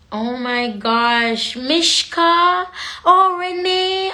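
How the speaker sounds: slow, drawn-out names, climbing high and loud; delighted calling out. Real, not acted. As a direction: sing out each name in greeting. Spots friends among live viewers.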